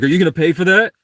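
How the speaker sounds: high, loud demand as the manager; confronting the customer over the water, loud and high; mock angry manager. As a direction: bark it like a fed-up store manager. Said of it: "She walks up and was like".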